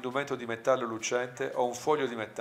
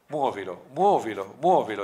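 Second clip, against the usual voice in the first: building, louder repeated command; insistent order in story reading; urgent exclamation; 6 dB louder than usual. A narrator's reading. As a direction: urge it on, louder with each repeat. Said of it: "esclamò Midnight".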